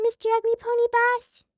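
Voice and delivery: high, loud little pony chirp; cutesy pony voice. Acted. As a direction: play the sweet little pony asking for mom.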